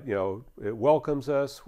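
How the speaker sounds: ordinary talk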